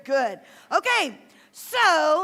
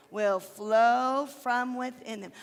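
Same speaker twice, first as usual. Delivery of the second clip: slow recitation; 4 semitones lower, much slower than usual. Shown as a demonstration. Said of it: "Can you do that?".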